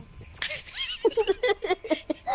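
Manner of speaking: long, high burst of laughter; laughing. Real, not acted. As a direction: crack up at a joke. Laughs at the listener's joke.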